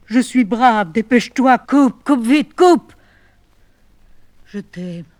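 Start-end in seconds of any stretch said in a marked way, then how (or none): 0.1-2.9 louder and higher with each word, near a scream; breaking desperate cry. Acted. Woman urging her lover to hang up, voice cracking loud. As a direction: force the brave words out as you break.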